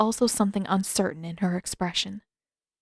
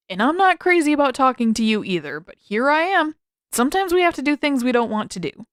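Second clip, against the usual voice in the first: irritated; 5 semitones higher, 4 dB louder than usual. A narrator's reading. Sokka arguing with Zuko.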